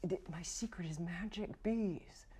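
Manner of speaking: whispered, conspiratorial aside; secret whisper. Acted. Beekeeper confiding his secret to the party in a whisper.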